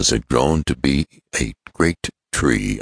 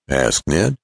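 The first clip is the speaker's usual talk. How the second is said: ordinary delivery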